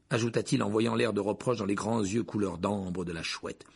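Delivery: usual speaking voice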